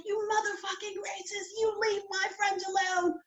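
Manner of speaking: high, furious British voice; Heidi. Retold or imitated. British girlfriend defending her friend, high British accent. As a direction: imitate an enraged Brit, pitched right up. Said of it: "Heidi's like".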